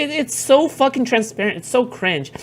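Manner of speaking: usual speaking voice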